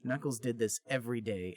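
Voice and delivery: ordinary talk, nothing marked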